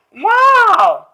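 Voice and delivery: high, loud gasp of Wow; excited wow. Acted. Teacher marvelling at the flying ladybug for the kids, excited. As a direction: wow the children.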